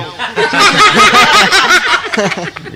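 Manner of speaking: very high peal of laughter; laughing hard at his own punchline. Real, not acted. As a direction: howl with laughter.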